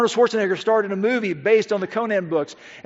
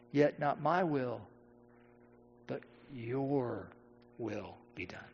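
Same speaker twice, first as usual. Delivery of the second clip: slow, hushed prayer, fading almost to nothing; a near-silent prayer of giving in; hushed surrender; 8 semitones lower, 14 dB quieter, slower than usual. Acted. Jesus's surrendered prayer in Gethsemane.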